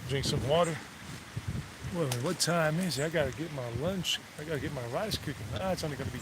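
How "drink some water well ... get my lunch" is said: ordinary talk, nothing marked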